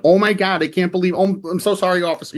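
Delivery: high-pitched, gushing apology to an officer; imitated flustered plea to police; code-switched Midwestern politeness. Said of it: "everyone code switches".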